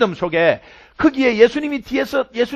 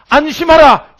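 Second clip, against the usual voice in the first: proclaimed loudly; 7 semitones higher, 8 dB louder, slower than usual. Acted. Climax of repeated Jesus words.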